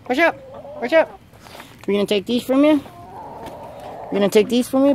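high-pitched pet talk. Real, not acted. Teasing his hens about taking their eggs, in high pet talk. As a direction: coo at the hens like babies.

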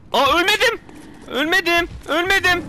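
high, loud triumphant shouting; real excited yelling; ecstatic disbelief. Real, not acted. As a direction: shout it, amazed to survive. Amazed at surviving in game.

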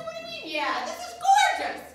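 squeaky high outrage, loud on gorgeous; shocked he isn't thrilled; indignant disbelief. Retold or imitated. Indignant at director's shrug.

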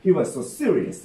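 ordinary delivery

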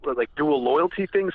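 usual speaking voice

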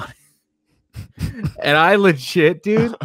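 slow, laughing words; laughing through it. Real, not acted. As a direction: let the laugh break the words. Laughing while retelling.

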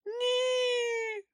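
high squeaky whimper; a pale little creature whining; squealing no. A narrator's reading. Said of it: "заскімлив зблідлий Чмих". (писклявий скиглий)

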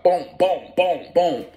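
high, punchy imitated gunshots; gunfire sound effect.

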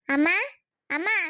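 calling for mother from afar. Acted. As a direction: call out to mother with a rising voice. Calling mother from another room. (దూరంలో ఉన్న అమ్మను పిలుస్తూ)

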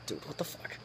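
very quiet, retold protest; hushed, exasperated aside; muttered exasperation. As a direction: mutter it under your breath in disbelief. Retold baffled reply to dad.

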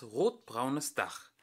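plain reading voice

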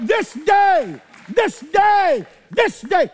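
high, loud repeated cry; preaching at full force. Acted. Preacher rousing the congregation, loud and high. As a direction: hammer the phrase like a battle chant.

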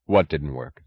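low, puzzled question; husband at a loss, understated; blank bewilderment. A narrator's reading. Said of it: "He asked in blank bewilderment".

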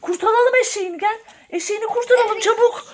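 high, urgent pretend panic; rally for a playful rescue; mock alarm. Toy donkey seized by pretend vampire.

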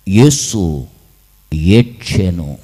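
low, hushed and drawn out; solemn sorrow. A narrator's reading. Preacher recites that Jesus wept, low and sorrowful. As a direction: let each word sink heavily.

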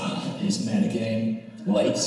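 low, hushed imitation of classmates; muttered remark. Classmates eyeing the student who walks in late, muttered.

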